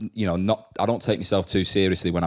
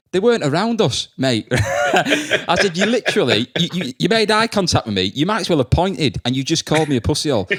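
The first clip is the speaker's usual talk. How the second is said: incredulous teacher; 9 semitones higher, 4 dB louder than usual. Retold or imitated.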